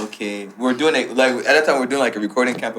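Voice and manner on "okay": soft, low-key "okay" under the breath; a muted okay as if taking notes; quiet knowing okay. Retold or imitated. Agent silently sizing up belongings.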